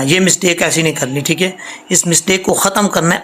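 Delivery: ordinary delivery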